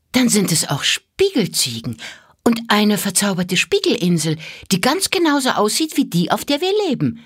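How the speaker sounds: put-on a child's growing excitement at a discovery; delighted girl. A narrator's reading. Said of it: "rief das Mädchen entzückt".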